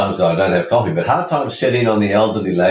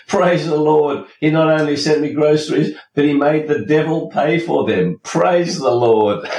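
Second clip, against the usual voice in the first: high, delighted praising; lightly voiced joyful old lady; gleeful old lady; 6 semitones higher than usual. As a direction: crow with triumph, clapping. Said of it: "started jumping up and down and clapping her hands".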